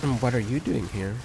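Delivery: lightly voiced innocent anime girl; mock an innocent anime heroine; ubu anime voice. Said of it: "my ubu anime voice".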